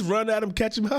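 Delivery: ordinary delivery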